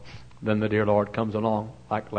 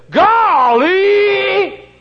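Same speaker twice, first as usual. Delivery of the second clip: very high, loud, long drawn golly; awed exclamation; 12 dB louder than usual. Retold or imitated. Little boy gasping golly at the preacher's office, loud and awed. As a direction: cry out in wonder. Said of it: "he said".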